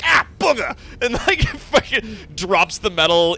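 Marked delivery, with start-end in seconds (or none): 0.0-0.7 high, loud burnt-finger yelp; quick mock-pained shout. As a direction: cry out as if scalded. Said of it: "accidentally burns themselves on a piece of hot metal".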